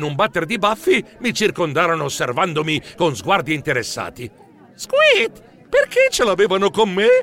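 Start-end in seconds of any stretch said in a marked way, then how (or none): 4.7-7.1 high squeak, then worried question; pitched-up bewildered fretting; comic alarm. A narrator's reading. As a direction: squeal in dismay. Hero alarmed by surrounding journalists.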